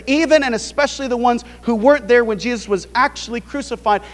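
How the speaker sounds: ordinary talk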